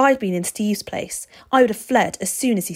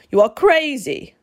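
loud, raised outburst; roaring; 7 semitones higher, 8 dB louder than usual. A narrator's reading. Vampire answering the boy's wish to become one, roaring. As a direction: roar it in disbelief. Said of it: "Mister Crepsley roared".

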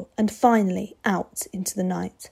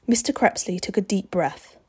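ordinary delivery, nothing marked

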